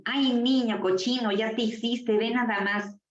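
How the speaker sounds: lightly acted mum fussing in disgust; a mock motherly scolding, starting high. Mother scolds her baby over a mess, lightly mocked scolding. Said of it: "llega mamá ... y nos dicen".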